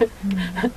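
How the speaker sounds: short high, soft chuckle; quick soft giggle. Real, not acted. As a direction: chuckle lightly at your own story. Chuckles at own bakery anecdote.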